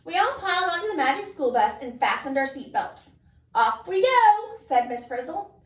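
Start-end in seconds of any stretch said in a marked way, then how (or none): 3.6-4.6 slow, high and loud send-off; cheerful. Acted. Miss Frizzle sends the class off on the bus, loud and gleeful. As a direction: launch the trip with glee. Teacher launching field trip.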